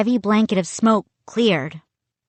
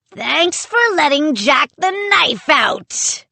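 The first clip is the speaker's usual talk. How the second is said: high-pitched pirate shout; put-on pirate voice at full cry; shouting pirate puppet; 8 semitones higher, 3 dB louder than usual. A narrator's reading. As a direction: crow it loudly like a little toy pirate. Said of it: "the little pirate shouted".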